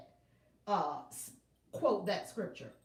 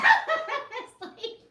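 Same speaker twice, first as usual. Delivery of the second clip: louder, high burst of laughter; 14 semitones higher, 11 dB louder than usual. Real, not acted. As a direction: crack up at yourself. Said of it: "Y'all got me cracking up here".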